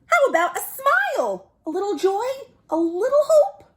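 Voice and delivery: high squeaky cheeky squid; squid's teasing. Acted. Squid coaxing the gloomy fish to smile, high teasing voice. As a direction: needle him in a high teasing voice.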